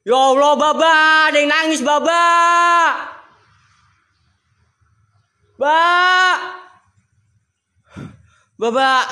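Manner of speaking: spooked, urgent yell; panicked calling. Real, not acted. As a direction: call out in alarm to someone far away. Hears crying, calls Baba for help.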